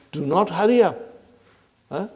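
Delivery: ordinary talk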